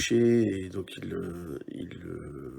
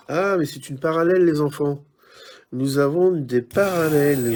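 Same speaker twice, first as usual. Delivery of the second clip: louder and higher, fast, thrilled; excited discovery; 5 semitones higher, 8 dB louder than usual. Real, not acted. Realises it's a parallel card. (excité, plus fort et plus aigu)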